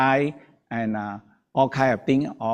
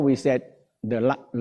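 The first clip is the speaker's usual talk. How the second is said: ordinary talk, nothing marked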